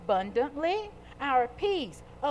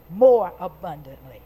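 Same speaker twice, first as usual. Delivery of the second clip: "more" loud and low; emphasis on more; 6 semitones lower, 9 dB louder than usual. Shown as a demonstration. Preacher on what Jesus came to give, emphatic "more". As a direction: hit "more" hard in a low voice. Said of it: "Put some emphasis on that".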